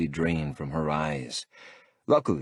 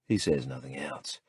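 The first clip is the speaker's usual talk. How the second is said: usual speaking voice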